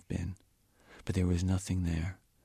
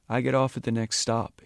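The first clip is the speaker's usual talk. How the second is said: raised, announcing voice; announcing loudly; 5 semitones higher, 6 dB louder than usual. A narrator's reading. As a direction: speak up for the whole car. Lowboy announces loudly to passengers.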